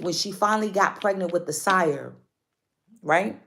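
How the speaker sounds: ordinary talk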